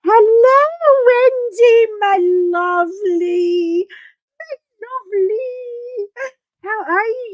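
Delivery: high, loud put-on greeting voice; sweet greeting voice. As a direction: gush over Wendy in a sing-song.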